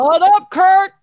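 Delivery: loud high call-out; shout. Real, not acted. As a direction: shout it across the room. Calling out to Kurt.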